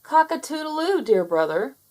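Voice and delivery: high crowing taunt; gloating rooster prince. Acted. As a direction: crow like a rooster. Henry crowing in triumph.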